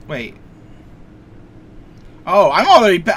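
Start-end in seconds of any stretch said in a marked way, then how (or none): 2.2-3.1 sudden loud realization; catching on all at once; surprised. Real, not acted.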